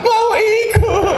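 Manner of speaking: put-on very high, slow childish whine; hammed-up childish plea; husband whining like child. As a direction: grown man begging like a toddler. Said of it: "bapak lagi".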